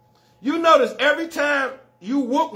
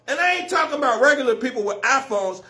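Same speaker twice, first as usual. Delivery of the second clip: ordinary delivery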